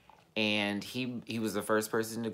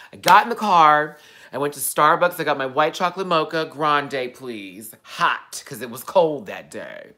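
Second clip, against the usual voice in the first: loud, higher, bubbly storytelling; excited; 5 semitones higher, 11 dB louder than usual. Real, not acted. Telling viewers about starting the drive, loud and excited. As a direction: gush about the coffee run. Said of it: "excited retelling".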